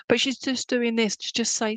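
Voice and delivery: ordinary talk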